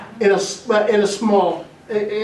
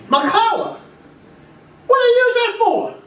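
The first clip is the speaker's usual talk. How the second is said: much higher, cartoonish dog talk; high dog voices; 13 semitones higher than usual. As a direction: chirp the dogs' dialogue up high.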